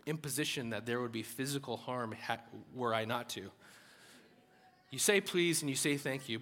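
ordinary talk

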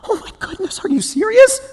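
high-pitched, incredulous exasperation; exasperated. Acted. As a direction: act out aghast disbelief. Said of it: "He's just exasperated".

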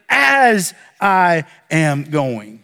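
"As I am going" drawn out very slowly, each word weighed; slow emphatic quote. Shown as a demonstration. Pastor slowly quotes the true command to congregation. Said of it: "But it starts with".

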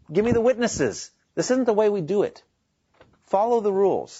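high-pitched, indignant insistence; acted protest, voice pushed well up; insistent demand. Jesus challenging his accusers at the trial, insistent demand.

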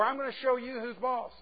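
bossy. Retold or imitated. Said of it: "you're getting a message".

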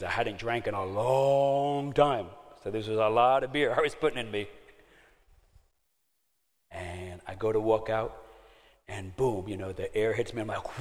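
ordinary delivery

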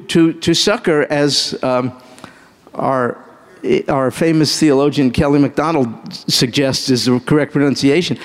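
ordinary talk, nothing marked

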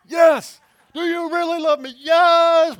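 high, loud shouted back-and-forth; escalating pep-talk shouts; pep rally shouting. Retold or imitated. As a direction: yell it like a fired-up team at halftime. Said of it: "a coach might do at halftime".

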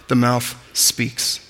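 "the mouth speaks" drawn out slow and soft; slow, emphatic close. Shown as a demonstration. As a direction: let each word land, very slowly. Same phrase said slowly for emphasis.

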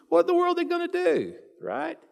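fast, high-pitched pulpit exclamation; rhetorical dismay. Acted. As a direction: throw the question up high and quick in disbelief. Preacher voices the family's dismay.

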